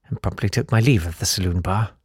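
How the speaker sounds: usual speaking voice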